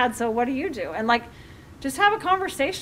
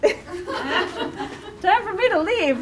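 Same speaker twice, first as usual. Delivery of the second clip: slow, higher voice through laughter; laughing mock escape; 7 semitones higher, much slower than usual. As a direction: play a kid making an amused getaway.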